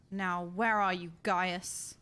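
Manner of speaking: normal talking voice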